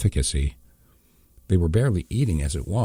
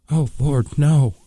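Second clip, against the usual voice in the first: high, loud horrified cry; anguished cry; 9 semitones higher, 6 dB louder, slower than usual. A narrator's reading. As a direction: cry out in horror. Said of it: "she called out".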